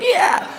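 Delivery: high mock shriek; short, high-pitched scare yell; scare scream. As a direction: imitate jumping out to scare someone. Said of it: "I can't do it 'cause my voice".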